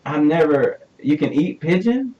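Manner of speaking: can't believe what he's hearing; surprised. Real, not acted. Host hearing pigeon is a favorite food, surprised. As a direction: pitch jumps up in disbelief.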